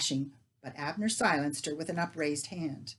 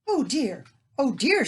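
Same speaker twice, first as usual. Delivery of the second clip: oh dear cried out louder and higher, distressed; distressed cry; 8 semitones higher, 10 dB louder than usual. Acted. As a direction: wail it in alarm. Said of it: "she cried".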